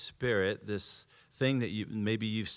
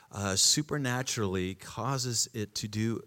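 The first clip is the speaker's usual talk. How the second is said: ordinary talk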